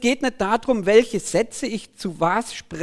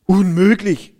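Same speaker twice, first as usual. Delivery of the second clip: louder cry of disbelief; acted exclamation; 5 dB louder, slower than usual. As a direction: call it out as a crowd's objection. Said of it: "riefen die anderen". (ungläubiger Ausruf)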